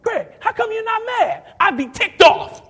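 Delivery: high, loud exasperated outburst; hammed-up boss who can't believe it; imitating mad boss. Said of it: "and he is mad".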